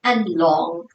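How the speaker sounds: soft, hushed meditation guidance with long pauses; guided meditation. Real, not acted. Yoga teacher settling the class into their breath, soft guided meditation. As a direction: ease them inwards, softly and unhurried.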